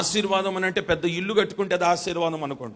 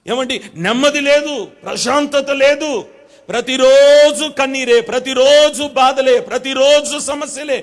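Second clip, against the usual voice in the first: higher, louder, slow lament of troubles; fervent preaching; 6 semitones higher, 10 dB louder than usual. Acted. Pastor lamenting the congregation's troubles, in fervent preaching. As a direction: preach the list of sorrows with rising fervor.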